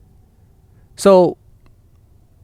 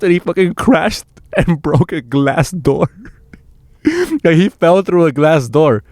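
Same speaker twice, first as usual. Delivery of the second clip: laughing; 3 semitones higher, slower than usual. Real, not acted. Laughs telling of the friend's crash.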